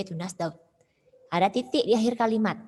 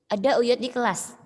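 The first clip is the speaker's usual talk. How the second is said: higher, louder class leader's order; firm command; 4 semitones higher, 5 dB louder than usual. Acted. Class leader telling classmates not to be noisy, firm command. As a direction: order the class firmly to stop the noise. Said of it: "Dengan tegas orang".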